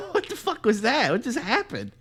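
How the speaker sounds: high-pitched baffled reaction; stunned disbelief. Retold or imitated. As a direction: react as if something just streaked past. Stunned friends react to kid bolting.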